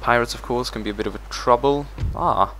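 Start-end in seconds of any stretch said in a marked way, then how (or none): none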